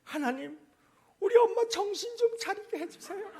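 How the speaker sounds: imitated little boy's plea, high-pitched; high, mock-earnest child prayer; praying small child. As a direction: pray loudly like a four-year-old. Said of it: "그 옆에서 기도를 하더라는 거예요".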